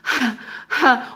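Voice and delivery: repeated mock huffs; heavy phone breathing. Mimicking a rude worker on a call, heavy exasperated breaths. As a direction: breathe out hard, imitating annoyance. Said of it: "breathe into the phone and shit".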